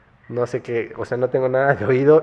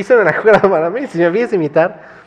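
laughing; 8 semitones higher, 10 dB louder than usual. Real, not acted. Laughing at own singing.

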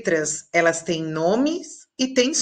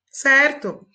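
usual speaking voice

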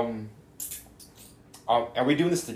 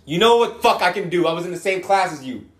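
loud, high indignant retort; raised voice, playfully affronted; mock outburst; 8 semitones higher, 8 dB louder than usual. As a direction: fire back in mock outrage. Mock outburst when asked for accents.

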